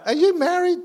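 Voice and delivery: playful high-pitched tease to the crowd; teasing squeak. Pretend. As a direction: pop the question in a squeaky joking voice. Teasing joke to congregation.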